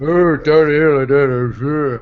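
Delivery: half-hearted slurred, drawn-out mumble; Stallone. Acted. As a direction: mumble it slowly, a failed impression. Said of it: "let's hear your Stallone".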